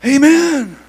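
loud, high, driving sermon with rhythmic uh's; fiery Pentecostal preaching; preaching at full force. Acted. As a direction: preach it hard to the congregation.

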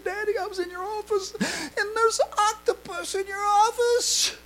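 imitating a frightened little girl; little daughter in tears. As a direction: daughter tells daddy about the octopus, tearful child voice. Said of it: "she was in tears".

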